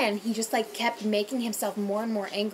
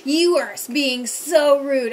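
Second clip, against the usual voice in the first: loud, very slow mock accusation; angry drunk; 5 semitones higher, 10 dB louder, slower than usual. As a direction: drag it out like an offended drunk. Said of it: "he was like".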